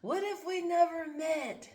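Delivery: high, slow, wistful what-if voice; high, drawn-out imagined lament; mock-dramatic musing. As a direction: play your own dramatic second-guessing. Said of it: "When you start questioning things like that".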